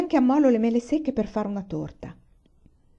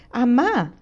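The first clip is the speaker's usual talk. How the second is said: put-on children answering together; 5 semitones higher, 6 dB louder than usual. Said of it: "e tutte risposero".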